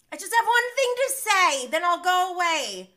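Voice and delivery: pleading whine. Retold or imitated. Recapper mimics Schwartz begging for one word, high whine. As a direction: beg to be heard, whiny and high.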